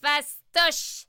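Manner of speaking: voix de sorcière aiguë qui s'écrie; sorcière vantarde. Acted. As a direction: lance ça avec assurance. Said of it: "s'écria Rabounia". (sorcière qui s'écrie)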